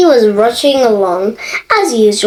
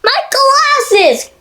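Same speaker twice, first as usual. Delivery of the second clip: high, upset cry of a man whose glasses broke; dismayed cry; 12 semitones higher than usual. Acted. Mister Clever crying out over his broken glasses, high wail. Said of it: "cried Mister Clever".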